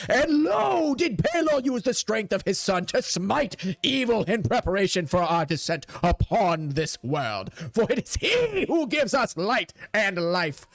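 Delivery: hammed-up fire-and-brimstone pulpit voice; sermonizing priest. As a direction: preach it with grand fervor. Priest delivers his sermon.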